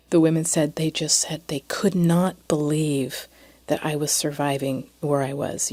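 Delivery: normal talking voice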